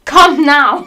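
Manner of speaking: high, loud barked order; hammed-up teacher's shout. Teachers shouting at the couple to come, loud command. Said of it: "started to shout us".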